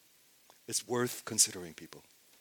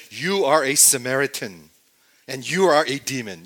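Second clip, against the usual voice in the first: loud, accusing retort; firing back; 8 dB louder than usual. Retold or imitated. Leaders firing back at Jesus with a loud insult. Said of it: "they fire back and they said".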